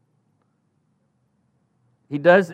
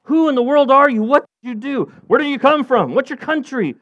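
much higher, louder overlapping questions; sailors bombarding; 10 semitones higher, 4 dB louder than usual. Acted. Sailors bombarding Jonah with questions, high and loud. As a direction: pelt him with questions all at once. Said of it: "they're all going".

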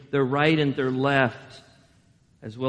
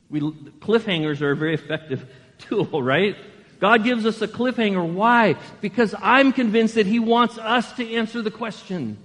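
ordinary talk, nothing marked

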